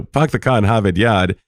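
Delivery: dropped r's, New England style; New England accent. Retold or imitated. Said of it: "that accent".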